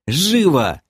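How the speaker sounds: high, urgent order; urgent command. A narrator's reading. Hurrying crew onto balloon. (подгонять криком, высоко)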